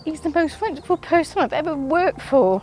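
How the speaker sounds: real emotion breaking in; emotional. Real, not acted. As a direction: push through the tears. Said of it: "sorry, I'm getting emotional".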